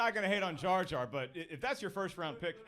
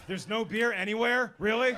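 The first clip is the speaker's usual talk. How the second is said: louder, disbelieving dad whining; whiny dad; 4 semitones higher, 9 dB louder than usual. Retold or imitated. Dad at Disneyland moaning about the missing beer, loud disbelief. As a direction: moan like a dad with no beer in sight.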